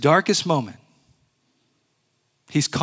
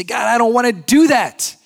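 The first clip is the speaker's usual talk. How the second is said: rebellious protest; 8 semitones higher, 7 dB louder than usual. Pretend. Voicing rebellious believer's protest.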